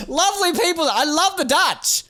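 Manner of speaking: high-pitched mock Dutch accent; attempted Dutch accent. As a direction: go high and hammy in fake Dutch. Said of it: "that has a Dutch accent".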